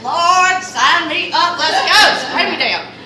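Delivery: loud, high, delighted cry; thrilled outburst. Retold or imitated. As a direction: grab the offer loudly, over the moon. Accepting spray tan offer.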